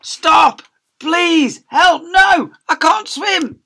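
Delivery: high, loud panicked cries; screaming for help. Acted. Kids drowning in tears screaming, high loud panic. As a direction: yell as if drowning. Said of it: "They screamed for help".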